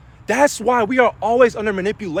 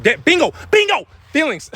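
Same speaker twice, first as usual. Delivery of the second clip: excited bingo; 9 semitones higher than usual. Real, not acted. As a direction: burst out as it clicks back. Suddenly remembers his topic.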